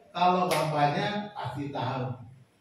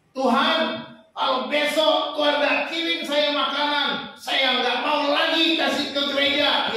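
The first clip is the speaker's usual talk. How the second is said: petulant, raised and loud, bargaining with God; whiny ultimatum pitched up high; sulky demand; 9 semitones higher, 6 dB louder than usual. Retold or imitated. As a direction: sulk at God like a spoiled believer.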